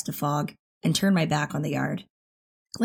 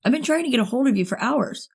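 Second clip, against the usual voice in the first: anxious; 7 semitones higher than usual. A narrator's reading. Worried boyfriend finally reaches her.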